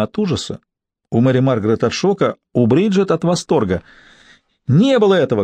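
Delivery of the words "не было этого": high, indignant mild yell; crying out. A narrator's reading. Narrator denies a lewd story to the group, crying out. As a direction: protest in a raised, higher pitch. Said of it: "крикнул я, очень не кстати пустив петуха".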